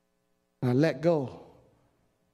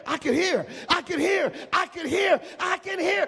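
shouting; 11 semitones higher than usual. Real, not acted. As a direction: proclaim it to the church. Preacher shouting testimony.

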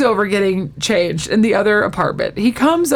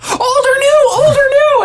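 very high, loud mock shriek; freaking out; 6 dB louder than usual. Monica hears her ex's message, freaking out. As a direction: demand it again in hammed-up hysterics. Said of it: "they all freak out".

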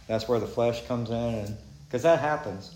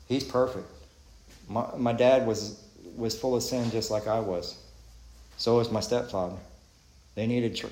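ordinary talk, nothing marked

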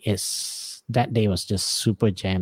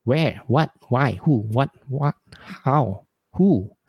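mock confused barrage; 3 semitones higher, slower than usual. Husband baffled by his wife's vague request, mock confused barrage. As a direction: fire off every question word at once, lost. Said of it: "And I'm like".

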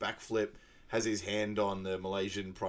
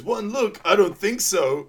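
high, loud, slow mock defiance; Derek comeback; 10 semitones higher, 8 dB louder, slower than usual. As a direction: toss off the line with model swagger. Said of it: "Derek goes".

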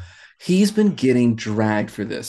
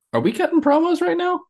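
pitched way up in disbelief; high squeaky question. Real, not acted.

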